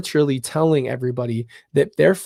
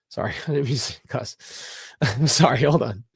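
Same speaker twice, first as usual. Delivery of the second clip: laughing apology. Real, not acted. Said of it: "I'm getting mad, obviously".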